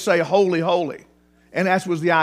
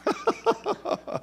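laugh; 8 dB quieter than usual. Real, not acted. Laughs after jingle.

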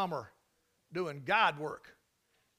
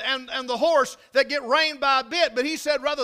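high, loud, ringing scripture delivery; full-force preaching; 6 semitones higher, 9 dB louder than usual. Real, not acted. Preacher declaring Christ the light to congregation, full force. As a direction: proclaim it with sustained force.

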